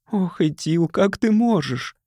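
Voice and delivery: reproachful wife near tears; crying wife. A narrator's reading. Wife weeping at Ethil across the room, tearful plea. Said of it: "плакала жена".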